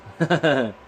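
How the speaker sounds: short high laugh at the mishap; a quick amused laugh. Real, not acted. Snapped lily, laughs at mishap.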